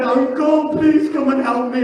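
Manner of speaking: high, loud pleading call; a boy's loud plea for rescue; desperate cry for help. Acted. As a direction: cry out to the uncle as if struck down. Fallen boy cries to uncle.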